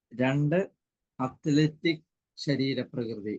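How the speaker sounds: slow, evenly spaced numbered points; slow dictation. Real, not acted. Teacher repeating list for late-joining student, slow dictation.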